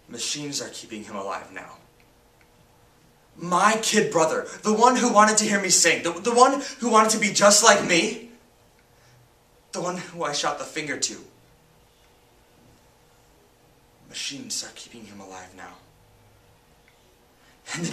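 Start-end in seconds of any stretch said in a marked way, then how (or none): 0.1-1.8 low, soft, heavy statement; hushed grief. Acted. Sibling telling of brother on machines, hushed grief. 14.1-15.7 very soft, low and drained; hushed grief. Acted. Sibling dwelling on brother's life support, hushed grief.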